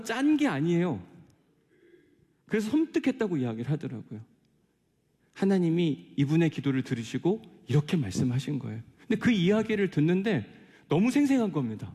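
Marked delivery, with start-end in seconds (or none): none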